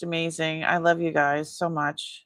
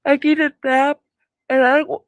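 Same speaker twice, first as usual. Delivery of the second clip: yawning; 10 semitones higher, 9 dB louder than usual. Real, not acted. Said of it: "I don't wanna keep yawning".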